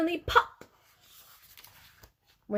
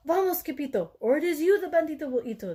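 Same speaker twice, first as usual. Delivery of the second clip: higher, fast urgent warning; fast, pitched-up frightened plea; panicked cry; 6 semitones higher, faster than usual. A narrator's reading. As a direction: hurry him off in a panic. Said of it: "they cried".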